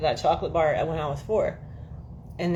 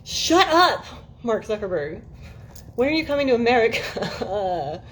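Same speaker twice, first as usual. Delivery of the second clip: indignant comeback; 5 semitones higher, slower than usual. Retold or imitated. Telling off Mark Zuckerberg, mock indignant and high. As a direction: snap back, high and sassy. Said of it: "I was like".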